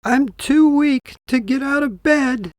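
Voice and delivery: put-on a weak old granny calling from bed; frail grandmother. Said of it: "Grandmother replied".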